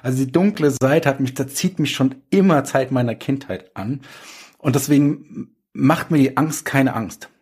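ordinary talk, nothing marked

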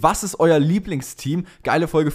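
ordinary talk, nothing marked

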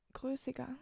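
whispered to herself; whispering. Real, not acted. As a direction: repeat größiger under the breath, unvoiced. Repeats the word in a whisper.

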